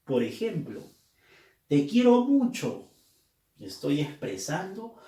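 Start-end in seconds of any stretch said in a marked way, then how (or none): 1.7-2.9 high, loud exclamation; bursting out with affection. Shown as a demonstration. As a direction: say it as a loving exclamation. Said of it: "aparecen entre signos de exclamación".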